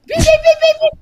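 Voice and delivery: high, loud alarm beeps; imitated alarm beeping. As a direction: beep insistently like a medical alarm. Imitates diabetic alarm going off.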